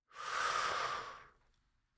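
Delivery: long pauses and soft sighs between single words; slow breathing pauses. Shown as a demonstration. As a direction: let each word sit after a breath. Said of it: "I am at the pause where I am out of breath".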